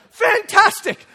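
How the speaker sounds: loud, high exasperated exclamation; mock delight. Dad learning of his kids' secret, sarcastic exasperation. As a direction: burst out with sarcastic enthusiasm. Dad hears kids are scheming.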